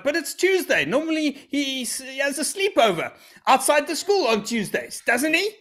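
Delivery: high, hammed-up clueless father; oblivious dad. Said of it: "my dad was like".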